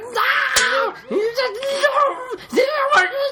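put-on very high, loud childish shriek; imitating a kid throwing a fit over milk. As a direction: screech no like a toddler in a tantrum. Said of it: "And he's like".

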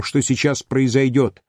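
ordinary delivery

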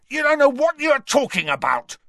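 high, loud, angry roar. Acted. Ron trying for a shot at Ginny, roaring. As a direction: roar it over the quarrel. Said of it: "Ron roared".

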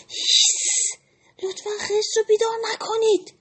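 high, anxious shushing from the animals; fearful hush. Acted. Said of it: "همه با ترس زمزمه کردن". (هیس کردن ترسیده و زیر)

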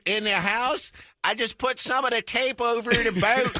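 Flex Seal guy. Acted. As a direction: pitch the product as a TV salesman. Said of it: "the Flex Seal guy".

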